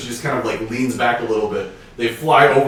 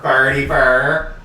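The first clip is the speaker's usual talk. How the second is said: put-on St. Louis accent; 4 dB louder, much slower than usual. Echoing the accent joke.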